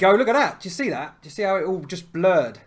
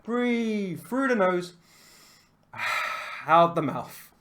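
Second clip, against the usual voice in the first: calm breathing cue; 5 semitones higher, much slower than usual. Shown as a demonstration. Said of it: "just breathe".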